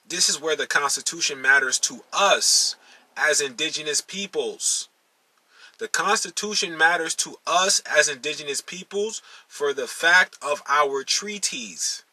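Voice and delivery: ordinary talk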